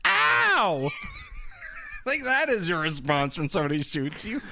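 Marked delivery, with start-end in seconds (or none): 0.1-0.9 very high imitated "ow"; exaggerated cartoon cry of pain; mock pained yelp. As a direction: yelp like a hammy actor who got shot. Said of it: "he goes".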